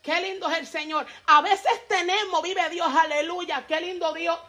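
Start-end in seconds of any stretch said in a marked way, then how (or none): none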